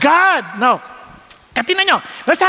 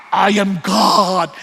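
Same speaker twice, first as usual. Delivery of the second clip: stern god; 9 semitones lower, much slower than usual. Retold or imitated. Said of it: "Kasi kung sa ibang religion".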